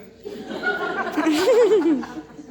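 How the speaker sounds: a hearty burst of laughter; real hearty laugh; loud laugh. Real, not acted. Laughs aloud.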